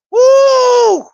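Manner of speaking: high, loud shouted woo; celebration whoop. Real, not acted. Celebrating the deal for his viewers, loud high whoop. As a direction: let out a big happy whoop.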